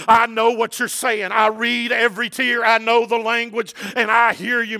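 high, loud sermon delivery; impassioned preaching. Real, not acted. Preacher urging the church about tears and prayer, impassioned preaching.

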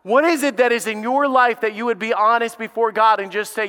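normal talking voice

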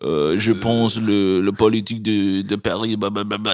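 hesitant mock French mumble; imitating French speaker. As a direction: parody a Frenchman rambling on in French. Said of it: "he'd be like, um".

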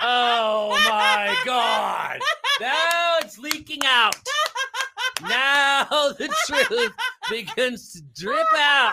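high, loud, slow laughing words. Real, not acted. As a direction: talk through hard laughter. Cracking up at a joke.

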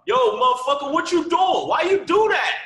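loud, high mock street yell; shouting heckler. New York guy yelling at the comic, loud and high. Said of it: "out there called".

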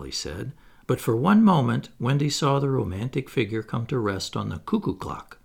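ordinary delivery, nothing marked

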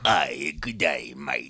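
low, slow villain voice in a hammed-up Australian accent; Palpatine with Australian accent. Co-host imagining an Australian-accented clone of the Emperor, hammed-up villain accent. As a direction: draw out an Australian greeting as a dark, low villain. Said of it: "They should have had Palpatine".

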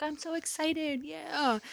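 put-on high, drawn-out little-kid excitement, kept soft; softly mimicked childlike glee; excited child. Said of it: "Maverick was excited the whole way".

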